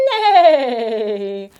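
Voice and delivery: loud whinnying horse; horse neigh. Pretend. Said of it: "The horse says".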